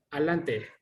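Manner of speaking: "adelante" clipped to "alante"; vulgar "adelante". Shown as a demonstration. Showing the class the wrong "adelante" as "alante". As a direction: drop the middle, say "alante". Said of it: "Lo incorrecto es".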